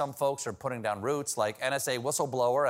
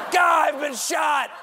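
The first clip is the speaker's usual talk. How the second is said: wounded soldier shouting much higher and louder; panicked shout; 12 semitones higher, 6 dB louder than usual. Acted. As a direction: scream for help after being shot.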